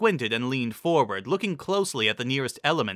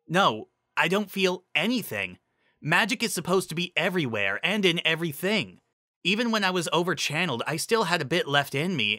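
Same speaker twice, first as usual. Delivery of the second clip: higher, earnest pony voice; Lyra's voice; 6 semitones higher than usual. A narrator's reading. Narrator voicing Lyra explaining the missing magic, higher.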